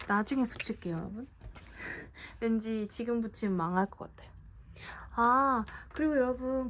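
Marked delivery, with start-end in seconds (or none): 1.3-2.3 quiet, breath-only little laugh; soft chuckle. Real, not acted. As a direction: chuckle softly to yourself. Laughs at hesitating over sticker. (작게 숨죽여 웃음)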